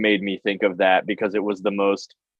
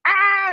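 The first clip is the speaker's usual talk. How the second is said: high, loud mock scream; mock panicked yell; 5 dB louder than usual. Tattooer diving under a table from praise, mock panicked yell. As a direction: yelp as if fleeing a compliment. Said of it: "dive under a table".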